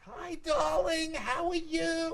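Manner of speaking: high, drawn-out greeting in an old-relative impression; put-on high aunt voice, stretched out; imitating aunt. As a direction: gush a slow, high-pitched hello as the aunt. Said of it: "So she's like".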